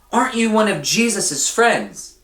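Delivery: put-on high girl's voice, curious; a young girl questions Peter at the gate; young girl asking. A narrator's reading. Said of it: "a young girl asked".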